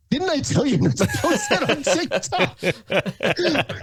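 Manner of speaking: high, slow, laughing imitation of the taqueria owner; playful high-pitched imitation of the owner scolding him; mock taqueria owner. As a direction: squeak it out like a teasing older man, laughing. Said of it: "and he's like".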